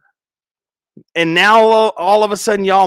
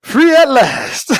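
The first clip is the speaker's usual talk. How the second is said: higher, savoring it; gleeful relief; 8 semitones higher, 3 dB louder, slower than usual. Shown as a demonstration. Mom freed from flag football season, slow gleeful cry. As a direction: celebrate the freedom slowly. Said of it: "second take raised".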